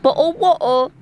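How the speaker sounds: mock British accent with swallowed T's; exaggerated British accent, glottal stops in bottle and water. Host echoing co-host's attempt, hammed-up British accent. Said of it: "say it in the accent".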